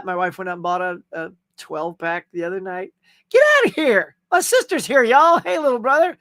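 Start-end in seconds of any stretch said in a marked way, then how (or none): 3.3-4.1 much higher and louder, gasping disbelief; surprised disbelief. Real, not acted. Wife bought twelve pack of eggs. 4.3-6.2 higher, louder happy shout-out; light up as his sister arrives; excited greeting. Real, not acted. Sister joins the stream.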